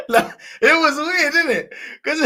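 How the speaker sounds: high, loud, laughing aloud; words bursting out through real laughter. Real, not acted. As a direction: crack up while saying it. Laughing at his own story.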